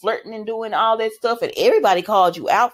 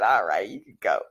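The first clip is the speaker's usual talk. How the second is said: much lower, curt dismissal; deep dismissive voice; 13 semitones lower, 3 dB quieter than usual. Retold or imitated. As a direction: drop the voice low and wave him off. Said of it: "She said".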